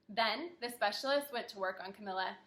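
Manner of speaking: ordinary talk, nothing marked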